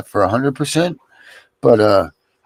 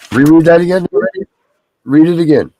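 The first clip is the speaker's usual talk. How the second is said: raised, high-pitched, loud demand; calling out a request; 9 semitones higher, 8 dB louder than usual. Real, not acted. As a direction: call it out loudly across the talk. Calls for a repeat reading.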